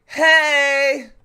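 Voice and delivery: loud high call across the street; sudden loud hey; shout. Retold or imitated. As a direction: yell hey to get attention. Someone shouts out.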